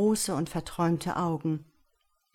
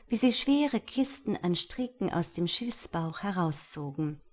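ordinary delivery, nothing marked

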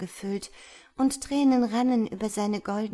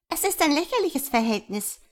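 high, louder twittering of gossiping swallows; chirping swallows; 9 semitones higher, 5 dB louder than usual. A narrator's reading. Gossiping swallows sneer at the love affair, high chirping. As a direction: chirp it out like a mocking bird flock. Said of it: "zwitscherten die anderen Schwalben".

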